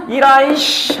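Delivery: high, sneering curse as the princess; mock curse. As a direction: spit out a petty curse, mocking. Princess cursing mother-in-law.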